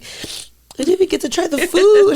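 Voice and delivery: high, loud comic wail with laughter; mock crying. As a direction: imitate a tearful whine, half laughing. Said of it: "like, crying, and I was like".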